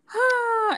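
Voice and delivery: high, audible sigh; exasperated huff of breath; loud sigh. Real, not acted. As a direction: sigh it out before moving on. Giving up after mix-up.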